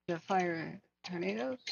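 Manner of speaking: ordinary talk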